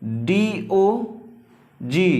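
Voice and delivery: letters drawn out one by one; slow spelling. Shown as a demonstration. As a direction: spell it out very slowly. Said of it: "spelling out letters". (अक्षर धीरे-धीरे बोलना)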